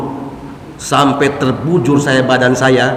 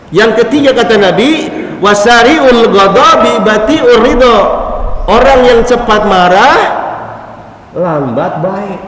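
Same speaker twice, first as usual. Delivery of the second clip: higher and louder, fervent preacher build; preaching at full force; 7 semitones higher, 3 dB louder than usual. Real, not acted. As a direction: drive the point home with rising fire.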